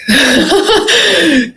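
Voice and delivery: bright high laugh breaking out. Real, not acted. Guest laughing at returning to her body. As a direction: laugh at the memory, pitch jumping up.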